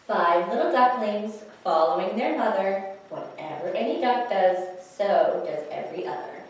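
lively storytime read-aloud; animated picture-book reading; reading to children. Acted. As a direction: read it like storytime for little ones.

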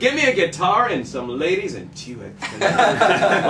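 laughing with delight as he says it; host laughing and delighted. Real, not acted. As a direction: bubble over with amused joy.

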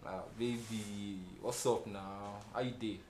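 slow, low, soft greeting; soft, drawn-out phone flirting; sweet-talking lover. Acted. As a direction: croon it to your sweetheart.

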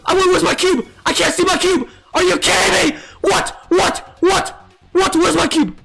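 raging gamer. Real, not acted. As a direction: shout in frustrated disbelief. Can't find cube, raging.

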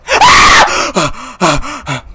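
high, loud scream then panting; startled scream. Real, not acted. Player jump-scared after hearing footsteps, startled scream. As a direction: scream and catch your breath.

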